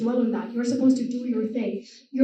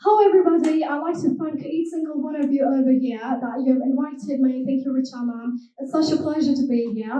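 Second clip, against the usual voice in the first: British accent. Shown as a demonstration. Showing the audience her accents, British accent. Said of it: "starting with the British accent first".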